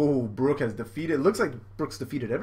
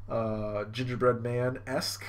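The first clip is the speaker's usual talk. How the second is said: normal talking voice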